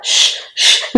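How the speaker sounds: whispered shush; a soft unvoiced shh; hushing. Ravi hushing again.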